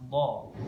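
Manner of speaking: "Do" eased out a little long; lengthened, heavy dhad on "Do"; slightly drawn out. Shown as a demonstration. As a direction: take time forming "Do". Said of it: "ada proses dalam pengucapannya".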